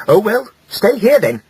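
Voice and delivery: high, clipped-off snap; snapped. A narrator's reading. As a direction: cut him off in irritation. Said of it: "snapped Hilda".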